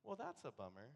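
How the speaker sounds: soft, understated mock nonchalance; calm outside. Pastor's calm outward reply to the painter, soft and chuckling. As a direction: play it cool and shrug it off. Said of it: "and outside I'm like".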